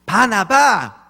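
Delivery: put-on slow, loud, drawn out child answer; child's proud answer. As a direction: say the name proudly like a little kid. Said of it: "오늘 뭐 배웠어?". (또박또박 외치는 아이의 대답)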